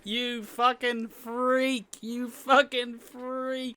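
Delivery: high, slow, drawn-out sneer; sneering Stacey. Retold or imitated. Stacey insulting Theo, high slow sneer. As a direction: stretch out the insult mockingly. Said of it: "she's just like".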